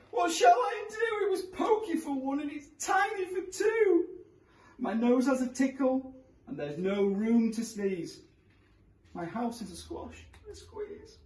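put-on high, wailing old woman; crying old lady. A narrator's reading. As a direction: wail it like a frantic granny. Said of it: "The little old lady cried".

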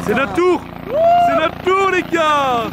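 excited. Real, not acted. Their turn to board the helicopter.

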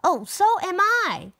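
heroic call. Acted. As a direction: announce yourself boldly. Second hero announces.

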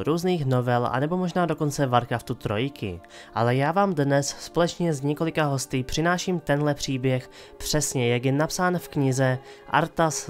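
ordinary talk, nothing marked

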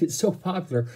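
usual speaking voice

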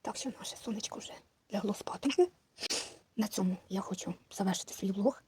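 barely audible bedside whisper; very soft, breath-only hush. Pretend. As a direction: whisper so the baby stays asleep. Baby has fallen asleep.

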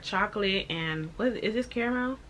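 chewing while talking; mouth full. Real, not acted. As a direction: food against the lips. Seen 4: pastry held at lips, chewing expression throughout the words.

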